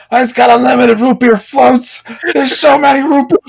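squeaky excited mock voice; high silly voice. Mocking friend's Red Robin job.

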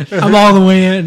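laughing through it. Retold or imitated. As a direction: giggle the words out. Acting out VR guy, laughing.

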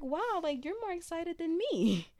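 high excited exclamation. Retold or imitated. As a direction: gush with surprised delight. Quoting friends' happy reaction.

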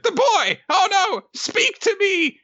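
very high, melodramatic alarm; Nobuteru. Acted. Creature finds his friend collapsed, high panicked cry. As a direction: squeal in cartoon panic. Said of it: "And he's like".

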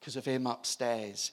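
lightly voiced old Bert, low and quiet; a hint of a gruff old man. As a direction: say it plainly like an old convert. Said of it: "He goes".

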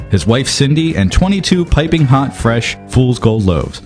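ordinary talk, nothing marked

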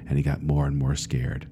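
ordinary delivery, nothing marked

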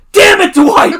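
high crying yell at full volume; anguished shout. Retold or imitated. Jim yelling at Dwight in anguish. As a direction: scream it like you're breaking. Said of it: "in that same tone".

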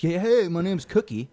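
hammed-up self-introduction; mock introduction. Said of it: "I never introduce myself as".

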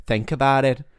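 emphatic imitated Irish accent; Irish headmistress. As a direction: address assembly in a put-on Irish accent. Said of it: "this is what gets me into the Irish accent".

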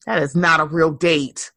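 normal talking voice

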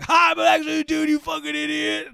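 3 shriek the gotcha reveal at him; 4 gleeful screeching gotcha; 1 mocking shout.

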